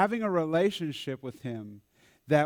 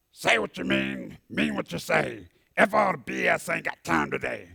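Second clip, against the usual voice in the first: lightly voiced blunt rhyming retort; reciting his rhyme. Why Me recites his rule when upset, lightly imitated chant. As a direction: punch out the little rhyme like the upset client.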